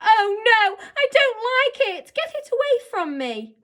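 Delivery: high, loud, panicky plea; frightened girl. A narrator's reading. Girl begging the boy to take the beetle away, scared. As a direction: push the creature away in fear. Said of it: "Novak shrank back into the chair".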